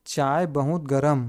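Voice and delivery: slow, word-by-word phrase; slow read-out. Shown as a demonstration. Teacher reading the phrase to students, slow read-out. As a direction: read it slowly, one word at a time. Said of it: "क्या पढ़ेंगे?".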